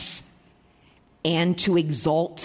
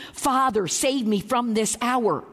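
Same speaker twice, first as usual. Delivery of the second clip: pleading; 4 semitones higher than usual. Acted. Jesus begs his Father to save him, pleading. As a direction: plead for rescue with falling phrase ends.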